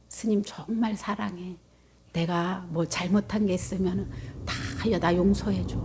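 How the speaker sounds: softly, almost under the breath; a quiet, tender silent prayer; hushed inner plea. Retold or imitated. To the monk who hates her, a hushed plea for forgiveness.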